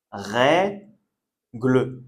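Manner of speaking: "Règle" split slowly as rè-gle, open "è" then neutral "e"; two E sounds. Shown as a demonstration. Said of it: "le E accent grave, on dit bien est". (règle découpé en syllabes)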